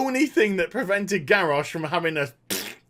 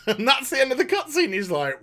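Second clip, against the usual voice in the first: ordinary delivery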